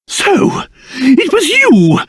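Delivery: high, drawn-out indignant accusation; outraged accusation. Acted. Father confronting his daughter's suspected kidnapper, accusing. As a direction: point the finger with outrage.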